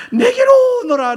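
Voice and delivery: fervent call. Pretend. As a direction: plead with full voice. God's call cried out. (간절히 부르짖으며)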